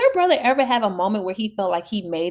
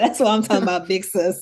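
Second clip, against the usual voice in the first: laughing while talking; 4 dB louder than usual. Retold or imitated. Retells a cheering quote, laughing.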